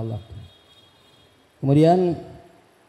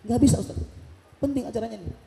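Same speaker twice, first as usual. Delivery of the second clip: menirukan ajudan; 7 semitones higher, 6 dB quieter than usual. Retold or imitated. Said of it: "kata ajudannya".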